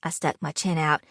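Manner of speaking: usual speaking voice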